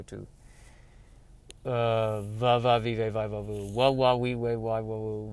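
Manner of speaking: soft, low mutter running through sounds; muttering syllables. Real, not acted. Podcaster hunting a forgotten name, soft low muttering. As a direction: mumble the syllables under your breath.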